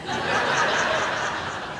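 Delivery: soft unvoiced laugh; quiet wheezy chuckle; breathless laugh. Real, not acted. Laughing at own prank story.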